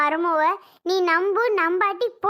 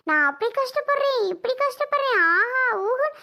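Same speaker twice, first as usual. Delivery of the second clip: higher-pitched mock lament; mocking whine; 4 semitones higher than usual. Woman mimicking another's tales of hardship, mocking. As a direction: parrot the complaints in a raised, mocking voice.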